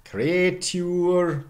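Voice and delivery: "creature" as KRAY-tyoor; high, stretched "creature" with ay; older pronunciation. Shown as a demonstration. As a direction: say "creature" in two syllables, y-glide. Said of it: "probably creature".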